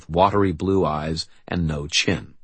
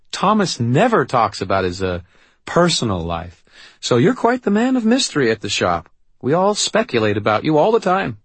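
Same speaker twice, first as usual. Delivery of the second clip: higher, louder beaming voice; chatty young woman; 8 semitones higher, 5 dB louder than usual. A narrator's reading. Young woman chats about the brother, high chatty voice. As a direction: gush in a high, friendly voice.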